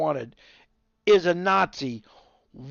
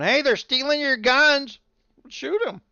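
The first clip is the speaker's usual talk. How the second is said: mock bystander shout; 10 semitones higher, 4 dB louder than usual. Bystanders yelling in parking lot.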